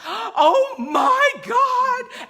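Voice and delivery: huge gasping, tearful shriek; loud, very high, every word stretched out; hysterically overjoyed outburst, close to crying; screaming with excitement. Shown as a demonstration. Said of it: "I'm crying and screaming".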